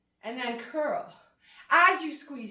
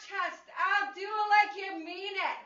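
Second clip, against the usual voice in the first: ordinary talk, nothing marked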